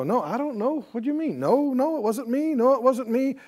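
quick, high denials from a crowd; crowd denying. Acted. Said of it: "everybody is denying".

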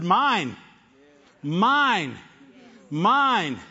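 loud, high, drawn-out cries of Mine; three loud claiming Mines, each falling; proud claiming cry. Acted. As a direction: claim each one like a treasure. God claiming you, preached.

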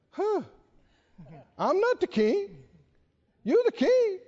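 high-voiced mock objection; protesting underling. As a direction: squeak out a reluctant protest. Acting a reluctant staffer's reply.

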